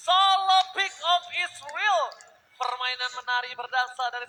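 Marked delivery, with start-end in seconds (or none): 0.1-2.0 slow, loud hyped call; fighting game announcer. Acted. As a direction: draw it out like a big caster line. Live esports casting climax.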